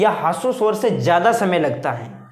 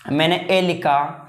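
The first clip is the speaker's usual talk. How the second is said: ordinary delivery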